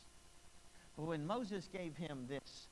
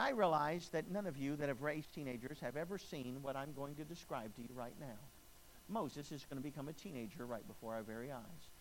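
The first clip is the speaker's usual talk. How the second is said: usual speaking voice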